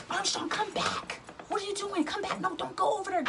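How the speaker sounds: frightened pleading. Retold or imitated. Friend Megan calling him back from the wreck, high frightened plea. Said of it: "So she's telling me".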